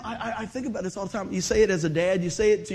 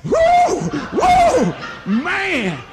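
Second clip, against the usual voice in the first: high, loud laughing whoops of shock; whooping yelps; 10 semitones higher, 10 dB louder, much slower than usual. Retold or imitated. Retelling getting zapped by the electric fence, loud laughing whoops. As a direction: yelp like the fence just zapped you.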